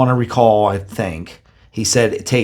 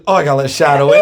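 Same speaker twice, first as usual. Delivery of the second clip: raised, loud mock announcement with a laugh; retold abrupt announcement; 5 semitones higher, 6 dB louder, much faster than usual. Woken partner leaving the paralyzed dreamer, retold abrupt announcement. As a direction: imitate a sudden waker, high and loud. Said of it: "He wakes up and goes".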